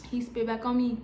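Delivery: ordinary delivery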